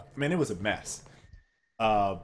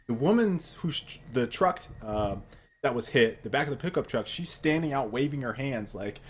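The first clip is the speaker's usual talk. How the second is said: usual speaking voice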